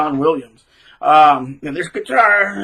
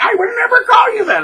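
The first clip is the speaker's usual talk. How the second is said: much higher, put-on innocent voice; mock innocent denial; 4 dB louder than usual. Narc pretending he never said the insult, mock innocent. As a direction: play the fake innocent, high and sweet. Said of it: "trying to pretend like".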